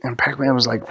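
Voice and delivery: plain reading voice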